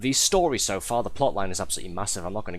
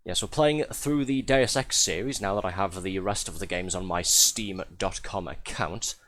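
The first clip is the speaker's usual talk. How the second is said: ordinary talk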